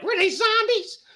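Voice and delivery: put-on lightly high child's question; curious little kid. Little boy asking about the movie, lightly high. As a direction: ask like a wide-eyed kid. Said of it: "I were like".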